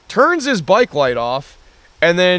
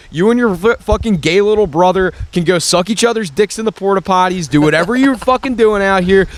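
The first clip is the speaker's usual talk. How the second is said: raised, high insulting rant; angry yelling; 5 semitones higher, 3 dB louder than usual. Retold or imitated. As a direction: yell abuse at them. Retold litany of insults.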